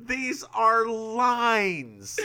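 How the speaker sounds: high, very drawn-out mock proclamation; hammed-up line delivery. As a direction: stretch every word, pitched high. Hammed-up quote of lyric.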